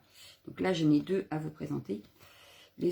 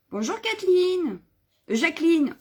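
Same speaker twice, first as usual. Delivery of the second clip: slow, sing-song hello, much higher and louder; cheerful greeting; 9 semitones higher, 6 dB louder than usual. Real, not acted. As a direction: stretch out a bright welcome to a viewer. Greets live viewers by name. (salut chantant, lent, bien plus aigu et plus fort)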